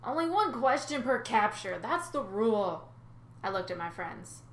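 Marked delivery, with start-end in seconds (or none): 0.0-2.9 put-on smug old man. Nereus states his rule.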